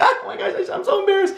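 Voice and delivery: very high-pitched flustered voice; comic falsetto embarrassment; mock embarrassed squeal. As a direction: squeak it out, hammed-up. Acting out life flashing before eyes.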